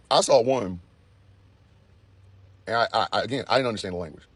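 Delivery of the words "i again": ordinary delivery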